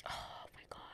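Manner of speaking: a breathless whisper, overwhelmed; whispered oh my God. Real, not acted. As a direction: let it slip out as a whisper. Overcome while talking about uncle.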